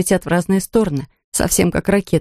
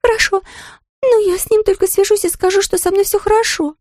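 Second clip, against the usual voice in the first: Dera's high voice. Acted.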